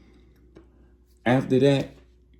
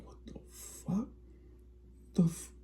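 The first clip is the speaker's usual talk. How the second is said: hushed disbelief trailing off at the f-; stunned whisper; 10 semitones higher, 15 dB quieter than usual. Retold or imitated. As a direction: breathe it out in shock, barely voiced. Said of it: "he's stunned by this".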